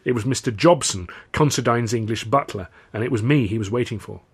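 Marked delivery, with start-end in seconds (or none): none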